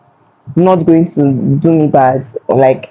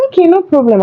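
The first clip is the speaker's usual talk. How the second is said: mock-breezy; 12 semitones higher, faster than usual. Brushing off petty man.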